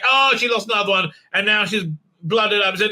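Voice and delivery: ordinary talk, nothing marked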